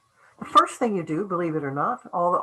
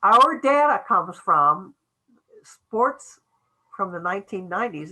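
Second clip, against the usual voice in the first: slow dictated sentence; 3 semitones higher, 5 dB louder, slower than usual. Shown as a demonstration. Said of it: "Your next sentence is gonna be".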